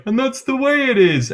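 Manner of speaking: high, put-on patron voice pitched well up; bar patron chiming in. Patron in the bar answers May. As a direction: sing it out like a cheeky barfly. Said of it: "someone else is like".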